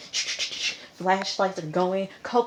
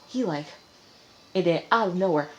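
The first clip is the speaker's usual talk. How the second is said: usual speaking voice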